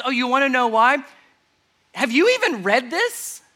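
lightly sarcastic challenge to the experts. Retold or imitated. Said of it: "a little sarcastically".

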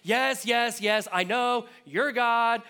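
loud, high, impatient "yes, yes, I know" voice; playing Martha fed up and brushing off the comfort; exasperated Martha. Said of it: "You have to say it like that 'cause she's basically responding".

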